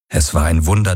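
plain reading voice

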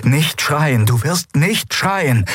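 high, increasingly desperate self-order; strained self-command. A narrator's reading. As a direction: force yourself to hold out against pain. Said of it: "befahl sie sich". (verzweifelter Befehl an sich selbst, hoch)

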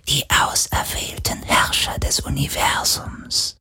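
soft reverent spirit whisper; rapturous ghostly whisper. Acted. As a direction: breathe it like a worshipful ghost.

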